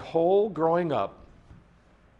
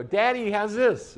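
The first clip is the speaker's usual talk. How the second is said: put-on eager little boy; 7 semitones higher, 4 dB louder than usual. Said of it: "we go".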